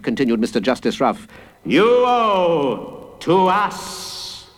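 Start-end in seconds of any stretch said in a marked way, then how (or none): 1.6-4.5 high, hugely drawn-out chant; crowd calling back. A narrator's reading. Big people answering the judge, calling back slowly. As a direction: stretch every word in a chorus-like reply. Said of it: "chimed in the big people".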